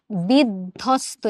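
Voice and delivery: বিধ্বস্ত slowly broken as "bid-dhosto", no b after d; sounded out. Shown as a demonstration. Teacher showing d and dh are pronounced, sounded out. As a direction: split বিধ্বস্ত between d and dh. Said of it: "এখানে দ আর ধ উচ্চারিত হচ্ছে".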